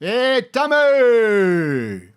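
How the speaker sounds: high, loud, slowly stretched call; fan calling out. Retold or imitated. Fan greets Tom on the street, a loud, stretched high call. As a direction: shout it long across the street. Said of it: "I get a lot of that".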